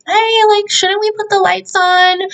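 high-pitched tentative suggestion; imitated meek wife. Wife asking her husband about the lights, high and tentative. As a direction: put on a high voice, asking carefully.

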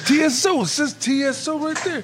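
very high-pitched indignant insistence; acted insistence. Ray defends his reading of the label, high acted insistence. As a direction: imitate someone stubbornly pointing at the proof.

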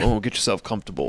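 low put-on voice; lightly voiced mock butler; mock posh voice. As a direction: tease a friend settling onto the couch. Teasing friend readjusting on couch.

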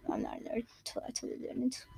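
soft unvoiced whisper; whispered aside. Real, not acted. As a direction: whisper it like a secret.

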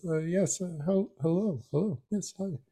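put-on flustered old doctor. Frank's flustered greeting.

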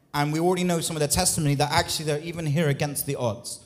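ordinary talk, nothing marked

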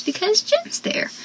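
high, soft, pitiful; mock-pathetic whine; whiny. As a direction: mope it. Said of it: "he goes".

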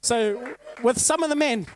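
loud, fired-up preaching, half laughing; full-force preaching. Acted.

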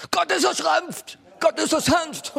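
very high, loud mock screaming; imitated screaming preacher. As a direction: scream it like an over-zealous prophetic preacher's interpreter. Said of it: "prophetische Schreihälse".

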